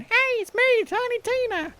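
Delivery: very high-pitched cartoon squeal of a voice; Tiny Tina. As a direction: imitate her in a piercingly high pitch. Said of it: "high-pitched voice".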